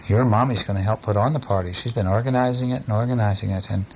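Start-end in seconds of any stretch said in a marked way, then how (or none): none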